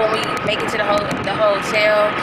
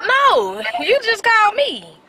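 indignant surprise; 11 semitones higher than usual. Real, not acted. To her friend on the phone, high surprised protest. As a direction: insist she was the one calling.

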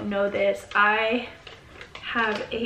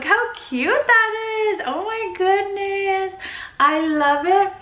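high, louder gush of love; gushing delight; 8 semitones higher, 3 dB louder than usual. Real, not acted. Showing viewers the golden tiger, high and gushing. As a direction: swoon over it out loud. Gushing over gold bath bomb.

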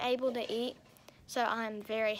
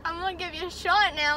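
dentist voice; 3 semitones higher, 5 dB louder than usual. Retold or imitated. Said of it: "And they were like".

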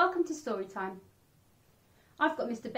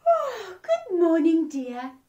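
higher, louder bright greeting; cheery Missus Bear; 6 semitones higher, 8 dB louder, slower than usual. Acted. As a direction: greet her sleepy husband cheerily. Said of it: "she said".

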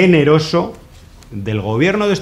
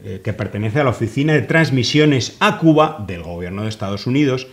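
ordinary talk

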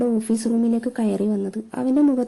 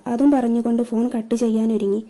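ordinary delivery, nothing marked

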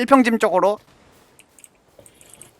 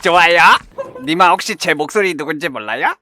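Pengsoo impression; 2 semitones lower than usual. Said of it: "펭수 성대모사로 한번 해보겠습니다".

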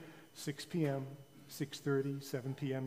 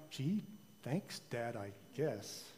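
sarcastic thanks; 4 semitones lower, 8 dB quieter, much slower than usual. A narrator's reading. Princess unimpressed by gift.

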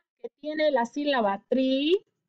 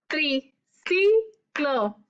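"Triciclo" very slow, tri-ci-clo separated; syllables counted; much slower than usual. Shown as a demonstration. Teacher counting three syllables aloud, slowly. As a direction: tap out "Triciclo" beat by beat. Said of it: "¿Cuántas sílabas hay?".

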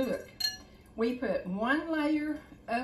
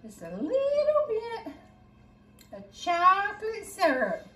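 "just a little bit" up high, louder and slow, teasing; playful drawn-out treat; 9 semitones higher, 5 dB louder, slower than usual. Pretend. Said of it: "sneak in here".

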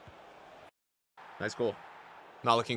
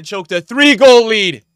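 high, very loud, drawn-out gloat; stretched-out jeering shout; taunting shout; 8 semitones higher, 17 dB louder, slower than usual. Real, not acted. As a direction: yell it slowly to rub it in. Taunt repeated louder at opponent.

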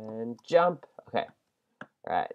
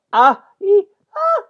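panicked squeals; 12 dB louder than usual. Real, not acted. Panic on pig.

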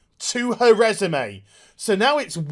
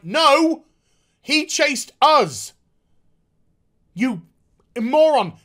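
higher, louder mock snap at the Monitor; exasperated retort; 6 semitones higher, 4 dB louder, slower than usual. Heroes scolding the Monitor in a loud exasperated voice. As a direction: throw "No, he chased us. You moron" back in mock outrage. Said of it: "they're just like".

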